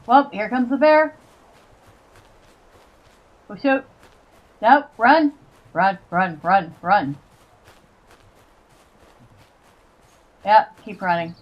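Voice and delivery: panicked run, run, run; being chased. Real, not acted. Fleeing bear and zombie in game.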